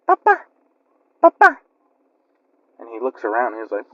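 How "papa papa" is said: put-on much higher, a tiny creepy child calling Papa; a monster baby crooning sweetly to lure him. The demon baby calls to the drunk uncle in a high creepy voice. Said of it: "he hears the baby say".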